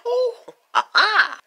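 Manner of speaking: high, loud gloating cackle; hammed-up villainous laughing fit; maniacal laughter. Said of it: "why are you laughing maniacally".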